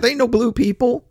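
ordinary delivery

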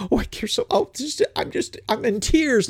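sarcastic high whimper of pretend crying; mock weeping. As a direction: ham up a crocodile-tears lament. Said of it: "your virtue signaling".

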